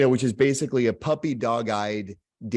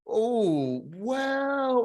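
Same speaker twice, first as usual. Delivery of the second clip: hesitant reply; 10 semitones higher than usual. Retold or imitated. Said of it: "And he goes".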